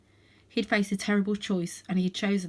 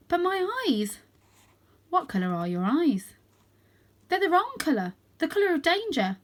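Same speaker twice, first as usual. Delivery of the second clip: put-on lightly higher young cat, worried; 9 semitones higher, 3 dB louder than usual. A narrator's reading. Varjak tells Jalal his worry, lightly higher voice. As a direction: worry about his eye colour.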